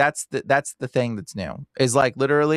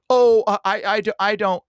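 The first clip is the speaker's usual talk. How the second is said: higher, louder hesitant stammer; imitated flustered stammer; 6 semitones higher, 3 dB louder than usual. Shop worker answering a stranger's question, mock flustered stammer. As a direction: mimic someone caught off guard, stumbling.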